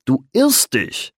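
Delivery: slow, lofty correction from the bard; the bard loftily corrects his friend; announcing. A narrator's reading. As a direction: declare it slowly like a grand verdict. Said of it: "verkündete der Troubadour".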